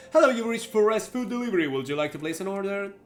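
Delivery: high, loud scripted phone greeting; delivery line operator. Acted. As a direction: answer like a customer service recording, pitch dropping after the greeting. Voices a food delivery operator.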